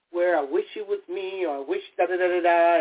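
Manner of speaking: normal talking voice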